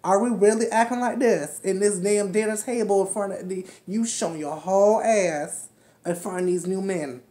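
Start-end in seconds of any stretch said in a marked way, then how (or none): none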